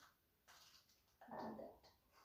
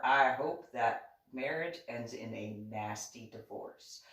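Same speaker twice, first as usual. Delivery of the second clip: usual speaking voice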